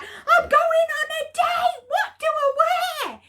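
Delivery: very high, loud excited shout. Retold or imitated. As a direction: yell it in giddy panic. Calling mum about date.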